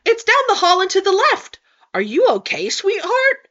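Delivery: high, sweet waitress voice; chirpy service voice, slightly breaking; customer service voice. Acted. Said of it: "customer service voice breaking slightly".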